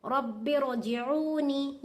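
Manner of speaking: "रब्बि रजिऊनी" drawn out slowly, ra full and heavy; slow recitation. Shown as a demonstration. Teacher reciting slowly to show the heavy ra. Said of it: "बारीक नहीं पढ़ना, पुर पढ़ना".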